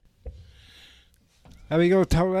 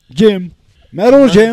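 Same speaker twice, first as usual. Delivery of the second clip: mock announcer shout; 5 semitones higher, 14 dB louder, slower than usual. Kid announces himself as Metal Jim.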